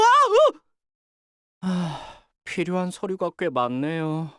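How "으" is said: sharp high loud grunt of effort; short strained cry, louder and higher; straining yelp. Acted. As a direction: yelp as if hauling heavy armor. Effortful cry while rushing.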